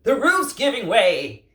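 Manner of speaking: high, loud alarmed yell. Acted. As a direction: yell a warning. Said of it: "yelled Toucan".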